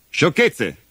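dismissive. Acted. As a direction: brush off the warning. Father dismisses the robot's warning. (tono sprezzante)

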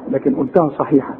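usual speaking voice

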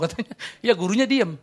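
ordinary talk, nothing marked

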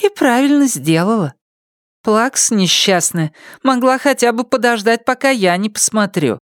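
high, catty, mocking thought; spiteful inner voice. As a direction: sneer it privately. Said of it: "подумала она".

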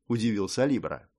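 ordinary delivery, nothing marked

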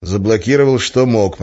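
usual speaking voice

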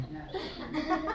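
quiet, high-pitched laugh; amused little laugh; soft chuckle. Real, not acted. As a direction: chuckle softly at your own impression. Laughs at own impression.

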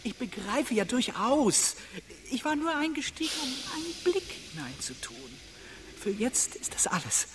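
high, soft, fawning voice; meek traveller. Acted. Timid traveller placating the angry railway man, high and soft. Traveller speaks humbly to official.

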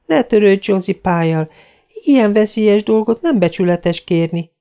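Diana warning. A narrator's reading. Diana warning Anne off the roof, high and anxious. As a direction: warn her friend, pitched high. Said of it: "próbálta visszatartani Diana".

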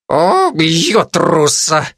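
through clenched teeth. A narrator's reading. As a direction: squeeze it out slowly with contempt. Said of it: "процедил попугай".

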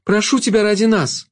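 high, urgent begging; desperate high-pitched appeal; pleading shadow. A narrator's reading. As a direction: implore her in a high voice. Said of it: "взмолилась тень".

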